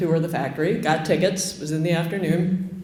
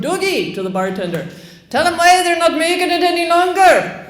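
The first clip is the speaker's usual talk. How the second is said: higher, louder shouted call across the room; yelled across the pub; 9 semitones higher, 5 dB louder than usual. Retold or imitated. Patron yelling to Dougie across the room, loud call. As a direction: call out to the bartender.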